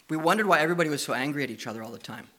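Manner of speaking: usual speaking voice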